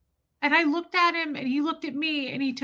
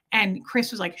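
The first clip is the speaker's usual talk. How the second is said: ordinary delivery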